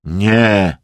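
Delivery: short, firm refusal; understated but resolute; quietly firm. A narrator's reading. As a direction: say no with restrained finality. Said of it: "lecz stanowczo Emerson".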